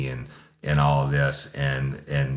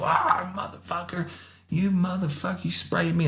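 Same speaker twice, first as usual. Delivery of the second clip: going off. Retold or imitated. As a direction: imitate a sprayed man cursing in a high strained voice. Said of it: "he's fucking going off".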